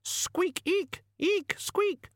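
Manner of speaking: very high little rat squeaks; chirpy friendly squeaking; happy squeaks. Acted. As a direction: Death of Rats squeaking merrily.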